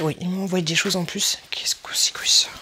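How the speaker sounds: whispering to herself; puzzled whisper, as if thinking aloud; whispered musing. Real, not acted. Vlogger puzzling over an unexpected extra item, whispered to herself.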